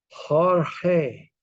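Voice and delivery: Jorge said as hor-heh, J and G breathed like h; Spanish pronunciation. Shown as a demonstration. Teacher asking his Spanish friend how she says Jorge. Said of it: "When you pronounce it as Jorge".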